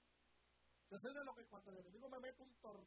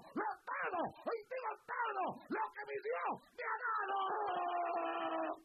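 loud, swelling preaching voice; rally them with rising force; full-force preaching; 6 semitones higher, 14 dB louder than usual. Acted. Preacher vowing never to surrender, loud and swelling.

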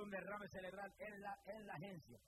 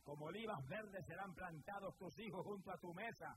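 raised, ringing sermon voice; fervent preaching; 5 semitones higher than usual. Acted. Preacher urging the listeners to accept Christ, fervent preaching. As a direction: preach it out to the whole church.

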